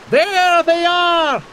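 high loud slow call of discovery; shouted. A narrator's reading. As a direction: shout it on spotting them. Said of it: "he shouted".

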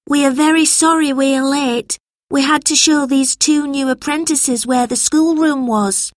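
put-on high-pitched boy making excuses; apologetic schoolboy. A narrator's reading. George explains being late to teacher, high apologetic voice.

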